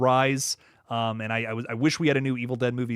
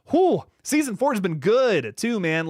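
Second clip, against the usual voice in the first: high, slow, drawn-out whew of delight; excited whew; 7 semitones higher, 4 dB louder than usual. Real, not acted. Streamer gushing to chat about The Bear, excited. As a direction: let the whew soar, then savour the rest.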